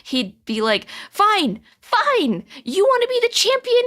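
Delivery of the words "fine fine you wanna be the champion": high, loud, frustrated outburst; Vinny, exasperated. Acted. Vinny quitting before his crush, exasperated rant. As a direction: act fed up, high and forceful, stretching words. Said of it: "exasperated, tears are rolling down".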